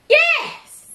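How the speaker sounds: high loud yelp of agreement; excited yes. Real, not acted. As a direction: burst out with a thrilled agreement. Excited agreement about suffering.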